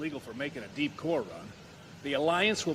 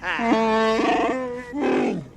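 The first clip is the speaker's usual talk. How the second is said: much higher breathy cheer, then a laugh; triumphant; 8 semitones higher, 6 dB louder than usual. Acted. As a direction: celebrate the narrow escape. Triumph after surviving the wall.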